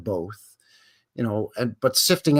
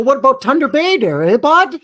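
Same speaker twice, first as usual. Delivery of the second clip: high, loud Canadian hoser voice with a big eh bud; hammed-up Canadian hoser; 13 semitones higher, 10 dB louder than usual. Teasing co-host about Canadian places, hammed-up Canadian hoser voice. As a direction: play the over-the-top Canadian buddy.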